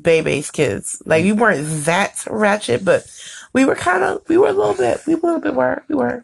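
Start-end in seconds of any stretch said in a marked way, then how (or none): 3.5-6.2 high, sheepish hedging; coy admission. Real, not acted. Coyly admitting they were bad.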